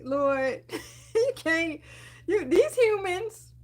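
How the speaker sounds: high, laughing sigh to the Lord; exasperated plea. Retold or imitated. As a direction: throw your hands up to heaven. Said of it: "I'm just like".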